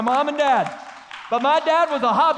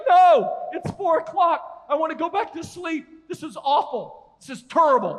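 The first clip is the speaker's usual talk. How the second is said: higher, whining groggy complaint; whiny protest; 5 semitones higher than usual. Retold or imitated. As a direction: whine like a sleepy kid. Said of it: "And I'm like".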